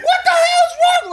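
very high, shrill panic; panicked wife. Retold or imitated. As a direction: squeal it in a panic.

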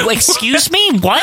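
high indignant excuse me; mock outrage. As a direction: act shocked and offended. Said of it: "then I'm like".

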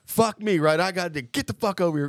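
high-pitched sarcastic mock rant; high, indignant instructor voice; imitated drill-instructor scream. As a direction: mimic a vulgar drill instructor chewing out a recruit. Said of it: "they scream. She's like".

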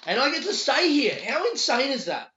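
high, loud, thrilled disbelief; real excitement. Real, not acted. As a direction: exclaim it, amazed at your luck. Thrilled at being let stay there.

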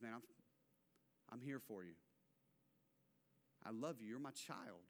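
very soft, low comforting voice; gentle, loving father voice; tender reassurance. Acted. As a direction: comfort a hurting child, hushed and low. Acts God comforting his hurting child.

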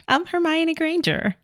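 high, proud mock declaration; mock Emma Watson. Mocking Emma Watson giving up her American accent, high proud voice. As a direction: announce it like a star dropping the act. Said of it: "Who are we kidding here?".

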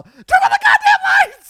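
very high, very loud panicked shout; all-out, high-pitched frantic yell; full retold yell. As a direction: re-enact screaming in fear at friends. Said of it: "I literally yell".

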